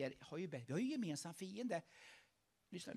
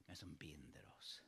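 whisper; 16 dB quieter than usual. Real, not acted. Preacher's hushed point.

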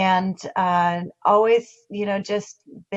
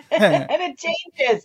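laughing; 5 semitones higher, 7 dB louder than usual. Real, not acted. Laughs at the big question.

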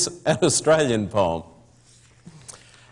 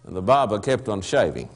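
low, softer dry refrain; 5 semitones lower, 3 dB quieter than usual. Acted. Narrator's refrain about the silent barber, low and soft. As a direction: drop it low and deadpan.

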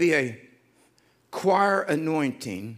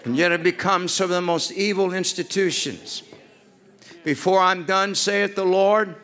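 loud, high preaching at full force; shouted prophecy; 5 semitones higher, 6 dB louder than usual. Real, not acted. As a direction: proclaim it with rising fire.

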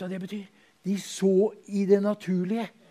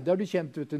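ordinary talk, nothing marked

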